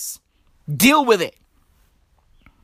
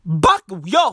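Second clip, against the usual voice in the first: ordinary talk, nothing marked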